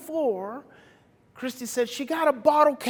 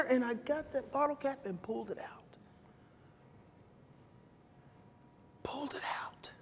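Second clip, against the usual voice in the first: hushed breathless whisper; awed whisper; 14 dB quieter than usual. Retold or imitated. Father recalling pulling the cap out, hushed whisper. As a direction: relief barely spoken. Finally freeing the bottle cap.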